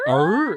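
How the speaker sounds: high playful imitated dog grumble; dog growl noise. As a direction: make the dog's little growl. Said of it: "you know how the dog goes".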